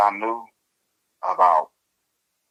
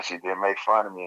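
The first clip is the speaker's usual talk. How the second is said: usual speaking voice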